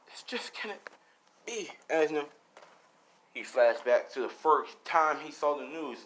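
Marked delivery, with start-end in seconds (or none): 0.1-1.7 broken whisper. Acted. David breaks down over Henry.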